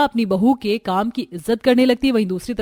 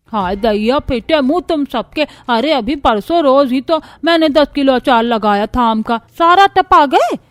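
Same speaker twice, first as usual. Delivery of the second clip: put-on louder, scolding old woman cursing everyone; angry grandmother's scolding; 3 semitones higher, 6 dB louder than usual. Grandmother-in-law cursing the family over the pickle, loud and furious. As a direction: rant like a furious granny. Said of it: "मुंह फुलाकर सब पर गुस्साने लगती हैं".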